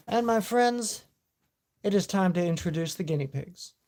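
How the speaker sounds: loud showman host voice; announcer voice. Acted. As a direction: announce it to the crowd. Announcing the guinea pigs.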